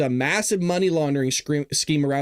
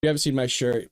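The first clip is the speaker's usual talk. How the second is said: normal talking voice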